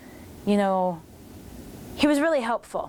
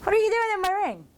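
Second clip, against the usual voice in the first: much higher and fast, mock indignant squeal; playful mock outrage, pitched way up; 11 semitones higher, faster than usual. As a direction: imitate Sumi's outraged who-are-you. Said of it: "Sumi's just kinda looking at him like".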